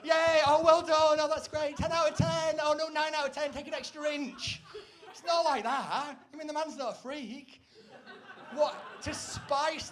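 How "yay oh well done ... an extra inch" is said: much higher and louder, gleeful cheering; mock cheering. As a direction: cheer yourself on like a game show. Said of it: "He's not like".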